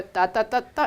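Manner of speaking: ta ta ta ta said broken, stopping in the middle; choppy stop. Shown as a demonstration. Teacher mimics the student's halting run, choppy. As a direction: chop the run and halt. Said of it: "and then stopping in the middle".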